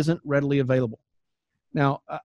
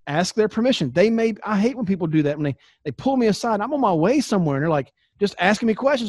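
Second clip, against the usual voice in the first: usual speaking voice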